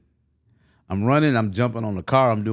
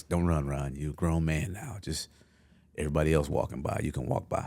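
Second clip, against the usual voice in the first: much softer, muttered pep talk to himself; self-talk; 5 semitones lower, 9 dB quieter than usual. Retold or imitated. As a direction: coach yourself under your breath. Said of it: "And I gotta tell 'em".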